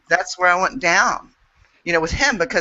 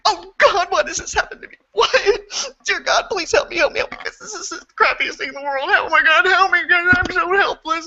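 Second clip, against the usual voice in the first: mock desperate prayer; 11 semitones higher than usual.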